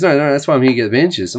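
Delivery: fast, offhand customer reply; rushed customer. Retold or imitated. Injured customer brushing off the pharmacist, fast. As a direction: rattle it off quickly. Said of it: "He goes".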